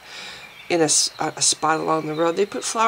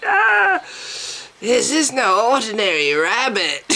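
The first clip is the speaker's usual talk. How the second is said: a hint of a British accent, weak; attempted accent; 2 semitones higher than usual. Vlogger doing a sad British accent about the rabbit. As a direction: try a quick British accent, badly. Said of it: "my really sad attempt at trying to do an accent".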